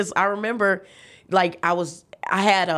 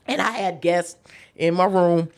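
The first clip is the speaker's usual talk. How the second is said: ordinary talk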